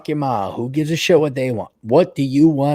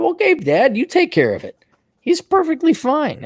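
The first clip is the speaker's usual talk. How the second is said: mocking dad voice.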